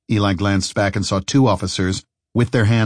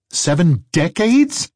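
louder, rising disbelieving question; incredulous; 9 semitones higher, 4 dB louder, slower than usual. Acted. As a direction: echo it in disbelief. Eli repeats in disbelief.

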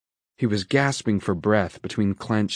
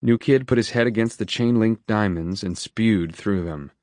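ordinary delivery, nothing marked

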